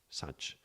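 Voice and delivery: "such" with short uh vowel; English U sound. Shown as a demonstration. Teacher showing the best pronunciation, "such" with uh. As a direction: say "such" to rhyme with "much". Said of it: "the way to pronounce it the best".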